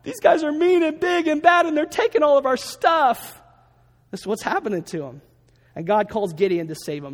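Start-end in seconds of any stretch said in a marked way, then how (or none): 0.0-3.4 high, loud, whimpering cry for help; hammed-up frightened wail to God; mock whiny plea. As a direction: play the scared Israelites whining to God. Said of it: "they're crying out to the Lord saying".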